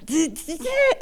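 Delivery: high, short laugh at herself; real wry laugh; rueful laugh. Real, not acted. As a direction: laugh off the pain. Laughing at the painful memory.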